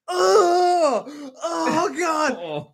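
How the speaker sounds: high, wailing mock shout; imitated loud groans of horror; crowd horror. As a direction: cry out like a disgusted crowd. Everybody reacts in disgust.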